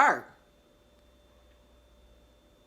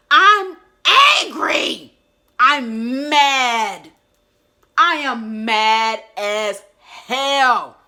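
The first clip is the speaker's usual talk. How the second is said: much higher, much louder, shouted with a cry in the voice; retold angry shout; 5 semitones higher, 14 dB louder, much slower than usual. Shouting her anger out during the healing session with Darius, crying yell. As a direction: yell it out like the rage finally breaks loose. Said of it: "times I shouted out".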